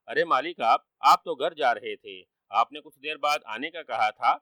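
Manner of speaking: ordinary delivery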